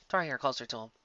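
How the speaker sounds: plain reading voice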